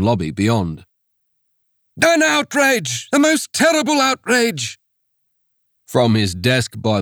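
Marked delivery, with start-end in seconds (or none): 2.0-4.8 high, strained cry of horror; a frantic reverend bursting in; horrified missionary. Acted.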